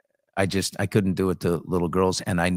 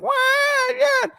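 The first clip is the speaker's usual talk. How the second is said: squeaky high wail of a little brother; high crying kid; 4 dB louder than usual. Retold or imitated. Kid brother yelping behind the wall, squeaky high cry. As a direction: brother cries out from the other room, way up high. Brother yelling during belt.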